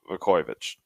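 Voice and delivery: low, soft offhand name drop; nonchalant. Retold or imitated. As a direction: toss the name off casually. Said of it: "very nonchalant w-, was like".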